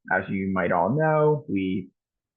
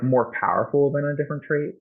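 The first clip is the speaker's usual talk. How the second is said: said as if in air quotes, pitch raised; scare quotes; 7 semitones higher than usual. Real, not acted. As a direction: lift the phrase into quote marks. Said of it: "quote unquote".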